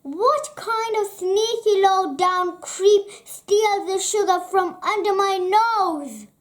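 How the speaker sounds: loud, high outraged yell; angry shout. Acted. Angry character shouts at the sugar thief. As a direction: bellow at the sneaky thief.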